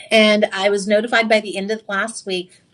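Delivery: ordinary talk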